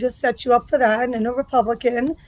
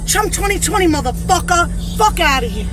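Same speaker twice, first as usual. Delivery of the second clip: furious rant; 5 semitones higher than usual. Acted. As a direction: rage at the politician, full force. Played viral political rant.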